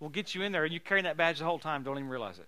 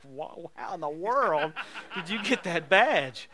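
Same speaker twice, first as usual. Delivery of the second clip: amazed trooper; 7 dB louder, slower than usual. Retold or imitated.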